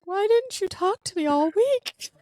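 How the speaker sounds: high, drawn-out mock wail; tearful questioning. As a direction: imitate asking through tears, pitch climbing to a squeak. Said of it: "And I just started crying".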